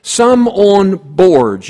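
slow, emphatic scripture line; slow reading. Shown as a demonstration. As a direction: drag out some on boards. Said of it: "And then it says".

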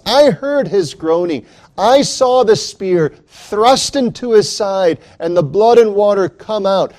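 incredulous, rising to an anguished cry; doubting Thomas. Acted. Thomas to the disciples, building disbelieving outburst.

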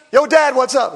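put-on loud, breezy teen voice; teenager greeting. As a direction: imitate a cool teenager hailing his father.

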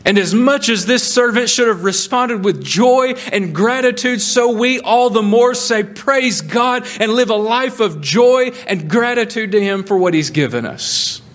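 high, ringing preacher's voice, pressing each phrase; full-force preaching. Acted. Preacher urging the congregation to grateful joy, ringing and fervent. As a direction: preach it out with fervour.

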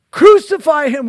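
loud high crowd shout; mob shout. Retold or imitated. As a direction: give it the full force of the mob. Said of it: "They cried out the more exceedingly".

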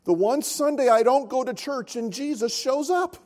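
put-on high, childlike voice with a laugh; little boy's voice. Pastor voicing the sick little boy's punchline, high and laughing. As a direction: play the sick boy delivering the punchline. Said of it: "he goes".

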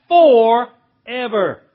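"forever" very slow, broken in two; long "for", pause, then "ever"; emphatic forever. Real, not acted. Climax of the preacher's promise.

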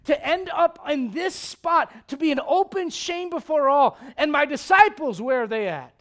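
loud, high, pleading lament; anguished Jesus. Retold or imitated. Pastor voicing Jesus on the cross, loud anguished lament. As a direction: pour out his shame at full force.

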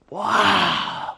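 soft, drawn-out wonder; hushed awe. Acted. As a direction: let it out softly, overwhelmed. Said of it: "you can't help but go".

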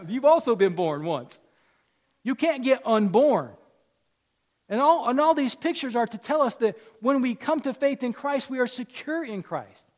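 loud, high, insistent pulpit delivery; forceful preaching. Real, not acted. Preacher telling congregation they're secure, forceful. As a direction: press the point on the whole room.

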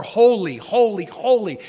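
each "holy" punched; firm beat on each repeated "holy"; emphasis by repetition. Shown as a demonstration. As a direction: hammer every "holy" for emphasis. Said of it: "Emphasis, brothers and sisters".

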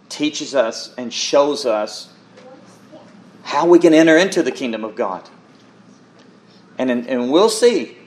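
normal talking voice

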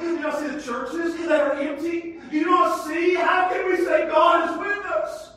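rising, shouted pulpit cry; preaching at full force. Real, not acted. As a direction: preach it loud and high to the congregation.

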